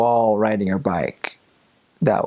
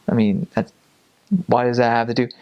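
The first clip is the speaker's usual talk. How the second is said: ordinary delivery, nothing marked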